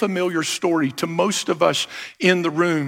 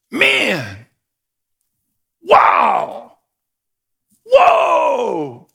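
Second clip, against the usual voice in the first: son's awed gasping exclamations, much higher; amazed kid; 4 semitones higher, much slower than usual. Retold or imitated. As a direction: gasp it out like a boy finding treasure. Son amazed finding box.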